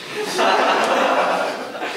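a big burst of laughter; bright loud laughter. Real, not acted. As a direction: laugh out loud, high and hearty. Audience laughs at swine story.

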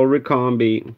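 ordinary delivery

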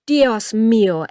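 loud, mildly groaned exclamation; alarmed groan. A narrator's reading. Matthias sees the stallion panic and the boy in danger, mildly alarmed groan. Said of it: "ächzte Matthias".

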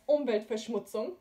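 Umweltverschmutzung with w as v and tz as ts; German Umweltverschmutzung. Shown as a demonstration. Teacher giving the right German word. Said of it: "so it's pronounced".

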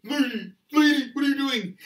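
high, loud shout of warning; alarmed driver's yell. Acted. Tired trucker sees lady in road, alarmed shouting. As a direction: yell at her to move.

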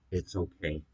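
soft, low, hushed reassurance; gentle comforting. Retold or imitated. Retelling mom comforting the upset speaker, gentle and soft. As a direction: soothe quietly in a low voice. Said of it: "She came over very gently".

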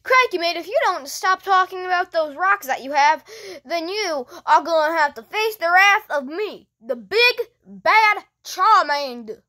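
hammed-up Aussie threat, delivered without stumbling; Australian accent. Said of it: "Being Australian is really hard".

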